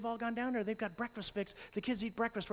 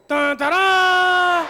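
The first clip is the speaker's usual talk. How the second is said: high, loud, slow shouted bugle; mock bugle fanfare; bugle charge; 8 semitones higher, 13 dB louder, much slower than usual. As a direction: blast it like a war trumpet. Girls' answering bugle.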